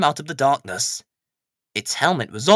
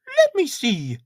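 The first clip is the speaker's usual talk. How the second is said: much higher, eager lady's voice; Miss Hubbard; 11 semitones higher, 4 dB louder than usual. Acted. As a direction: lean in curious and keen. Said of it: "said Miss Hubbard".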